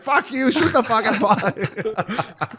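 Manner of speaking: imitating the fan telling off the guy who asked him to stop swearing; defiant fan. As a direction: drag it out defiantly at the guy in front. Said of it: "He's like".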